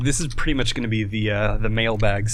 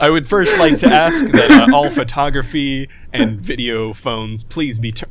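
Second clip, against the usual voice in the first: press conference voice; 3 semitones higher, 7 dB louder than usual. Pretend. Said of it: "press release style".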